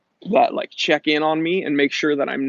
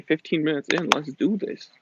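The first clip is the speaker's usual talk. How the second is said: ordinary talk, nothing marked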